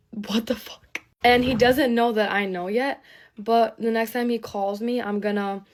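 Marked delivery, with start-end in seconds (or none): 0.1-1.0 whispered in disbelief; stunned whisper. Real, not acted. Woman reacting to being cheated on, stunned whisper. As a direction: barely voice it, stunned.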